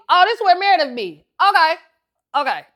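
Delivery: giddy star-struck outburst; excited squeal. Retold or imitated. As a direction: squeal like a fan on a TV set. Excited entering operating room.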